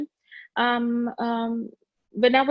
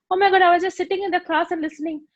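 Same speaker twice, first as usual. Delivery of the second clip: fast, much higher, louder alarm; shocked; 8 semitones higher, 4 dB louder, faster than usual. Retold or imitated. Student startled by friend's accusation, shocked. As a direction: rush it out in a panic. Recalled inner shock at classmate.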